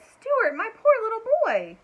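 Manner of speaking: high, loud, worried cry; alarmed mother. A narrator's reading. Mother finding her son in the fridge, alarmed cry. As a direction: rush to him in alarm.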